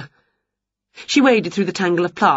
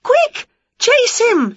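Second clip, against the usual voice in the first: very high, loud cry; shrieked chase command; urgent shout; 5 dB louder, slower than usual. A narrator's reading. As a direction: shout a frantic order. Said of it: "shouted Winnie".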